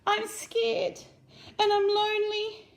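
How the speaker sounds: very high, loud little seed calling up; small muffled voice. A narrator's reading. Seed calls up to Fox from underground, high and small. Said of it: "came little Seed's muffled voice".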